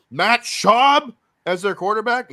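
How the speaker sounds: loud, high disbelief; incredulous. Real, not acted. Incredulous repeat of a player's name.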